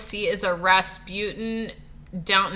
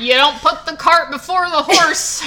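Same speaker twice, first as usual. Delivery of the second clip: folksy saying, laughing; 7 semitones higher, 6 dB louder than usual. Retold or imitated. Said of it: "that dog don't hunt".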